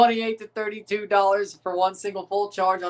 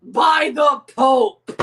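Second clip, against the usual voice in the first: slow, higher, louder mock oath; mock solemn oath; 5 semitones higher, 7 dB louder, much slower than usual. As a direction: swear it like a crusader. Mock crusader oath, slowed.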